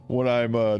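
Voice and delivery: ordinary delivery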